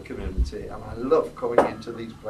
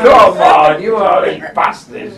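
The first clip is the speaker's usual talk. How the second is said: loud, slow teasing shout at the crowd; playful shout; 3 semitones higher, 14 dB louder, slower than usual. Real, not acted. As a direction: bellow it out, daring the room. Shouting at crowd about pasties.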